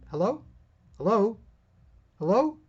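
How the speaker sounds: calling into dead line. Pretend. Caller gets no answer.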